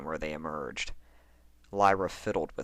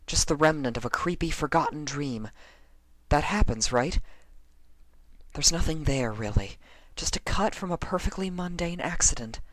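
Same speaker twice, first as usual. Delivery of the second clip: higher, nervous self-reassurance as Lyra; Lyra talking herself down; 10 semitones higher, 3 dB louder than usual. Acted. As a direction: pitch up and talk yourself out of the fear.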